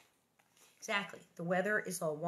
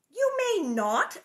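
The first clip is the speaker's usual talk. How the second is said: slow, much higher and louder; firm refusal; 11 semitones higher, 9 dB louder, slower than usual. A narrator's reading. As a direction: lay down the law to the child. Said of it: "Ma firmly refusing".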